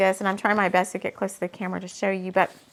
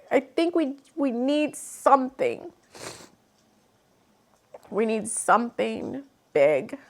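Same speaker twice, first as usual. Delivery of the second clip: getting emotional; 2 semitones higher than usual. Real, not acted. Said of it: "I guess I'm getting emotional".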